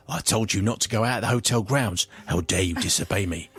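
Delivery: lightly voiced, low scolding guide; angry guide. Guide telling off disobedient guests, lightly acted low scolding.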